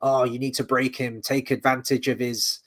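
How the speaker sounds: normal talking voice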